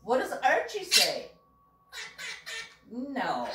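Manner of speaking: ordinary talk, nothing marked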